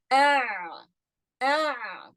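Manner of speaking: ah pushed from the middle of the throat; throat demo. Shown as a demonstration. Teacher shows class the ayn, sounding ah. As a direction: sound ah, partly open, partly closed. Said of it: "You see that?".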